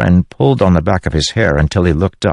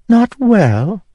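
high, scornful echo; queen scornfully repeating her husband; mocking queen. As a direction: throw the words back at him.

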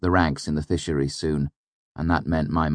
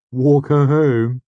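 slow, much higher and louder teasing echo; suggestive; 10 semitones higher, 9 dB louder, slower than usual. A narrator's reading. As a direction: lean on it with a knowing wink. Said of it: "he said suggestively".

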